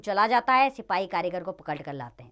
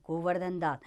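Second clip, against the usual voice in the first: ordinary delivery, nothing marked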